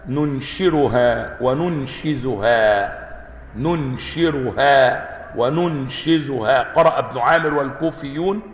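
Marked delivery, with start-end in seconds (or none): 0.1-6.6 "ننشرها" بالراء و"ننشزها" بالزاي ببطء; فرّق بين الراء والزاي بتمهّل; قراءتان. Shown as a demonstration. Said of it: "ما يتغير بالنقط". (نطق متأنٍّ يُبرز النقطة)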